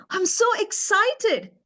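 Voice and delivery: high, drawn-out burst of happiness; excited. Shown as a demonstration. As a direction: pitch soars on a stretched "excited". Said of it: "excitement means a slightly higher level of happiness".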